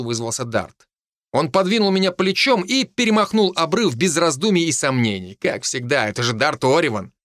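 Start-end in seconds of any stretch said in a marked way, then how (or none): none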